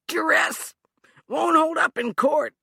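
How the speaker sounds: high, loud, pained gasp; gasped through pain. Acted. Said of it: "Hugh gasped through the pain".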